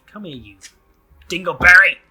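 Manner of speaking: sudden loud, high yell; playful shout. Pretend. Calling someone a silly name in game, playful shout.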